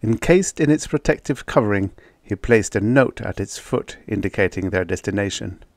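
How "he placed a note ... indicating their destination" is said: ordinary delivery, nothing marked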